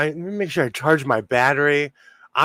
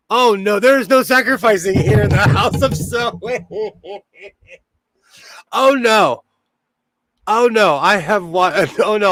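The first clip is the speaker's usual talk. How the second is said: laughing protest; 5 semitones higher, 4 dB louder than usual. Real, not acted.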